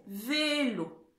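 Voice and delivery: VÉLO with a drawn-out, clear "é"; son é accentué. Shown as a demonstration. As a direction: hold the é in "Vélo". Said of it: "je veux le son et". (« Vélo » avec un é bien allongé)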